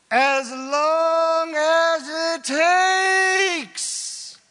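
very loud, high, drawn-out bellow; impassioned shout. Real, not acted. Preacher answers how long to keep apologizing, impassioned shout. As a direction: belt it out, stretching every word. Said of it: "I pegged the meter right there".

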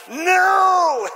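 high, loud, drawn-out refusal; passionate yell. Acted. Kids leap up to answer the king, passionate yell. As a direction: yell it like an outraged crowd. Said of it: "with great passion and yell".

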